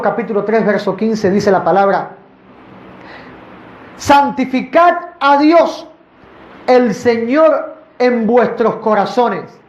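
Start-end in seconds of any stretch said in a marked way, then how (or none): 4.0-9.6 slow, high, drawn-out reading of the verse; solemn scripture reading. Acted. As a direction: proclaim each word of the verse slowly. Reads scripture aloud.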